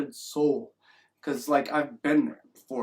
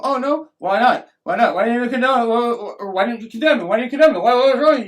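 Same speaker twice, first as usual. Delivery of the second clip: loud, raised-pitch mock interrogation; badgering; 6 semitones higher, 7 dB louder than usual. Society grilling someone over not condemning him, badgering. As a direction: pester with piled-up, stumbling demands.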